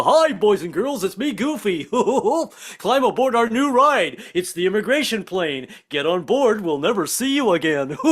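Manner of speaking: high, goofy cartoon voice with laughs; bumbling cartoon dog greeting kids. Goofy greets guests at the gate in a high goofy voice. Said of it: "Goofy will show up".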